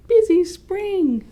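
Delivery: put-on high, loud, slow child shout; a drawn-out happy shout. A narrator's reading. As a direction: belt it out like an excited little girl.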